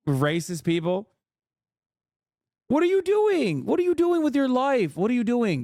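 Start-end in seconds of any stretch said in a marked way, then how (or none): 2.7-5.6 exasperated scolding. Acted. Mocking a woman on stream.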